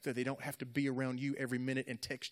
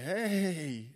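drawn-out, higher, louder hey; raised, elongated hey in a retold reply; mock greeting; 6 semitones higher, 5 dB louder than usual. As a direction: stretch out an overdone greeting. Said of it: "she was so happy and so smiley".

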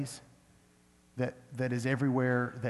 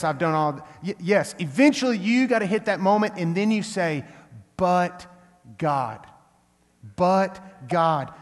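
raised, emphatic pulpit voice; full-force preaching; 4 semitones higher, 5 dB louder than usual. Real, not acted. Preacher urges congregation not to trust emotions, full-force preaching. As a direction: preach it loud, driving each point home.

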